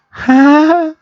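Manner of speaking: put-on high eerie child's "huh?"; creepy little kid. Telling friends about the game, creepy kid voice. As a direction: sound like a ghost child asking. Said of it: "a little kid goes".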